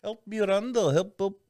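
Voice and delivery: high-pitched mock Indian accent; sing-song put-on Indian accent; imitated Indian accent. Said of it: "he has such a dope accent though".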